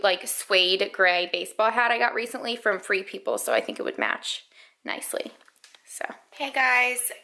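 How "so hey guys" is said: slow whispered greeting; whispering. Real, not acted. Mother whispers a slow hello to viewers. Whispering so baby stays calm.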